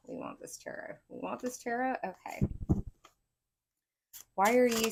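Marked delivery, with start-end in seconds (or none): 0.1-1.0 whispered under the breath; a voiceless murmur of the cards' wish; muttered aside. Pretend. Cards answer, muttered then asked. 1.1-2.0 rising, questioning recheck; surprised question. Real, not acted. Checking back as a question.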